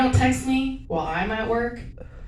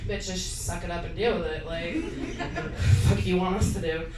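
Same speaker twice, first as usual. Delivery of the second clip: friends' blunt brush-off; 4 semitones lower, 6 dB quieter than usual. Retold or imitated. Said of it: "they're basically like".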